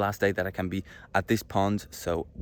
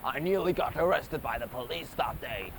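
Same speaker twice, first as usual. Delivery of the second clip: put-on super-old person's voice; mock old storyteller; 3 semitones higher, 3 dB quieter than usual. Super old, telling the children, put-on elderly voice. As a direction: tell it like an aged parent reminiscing. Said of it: "When I'm going to be super old".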